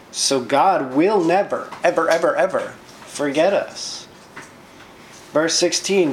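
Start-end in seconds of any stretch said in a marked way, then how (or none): none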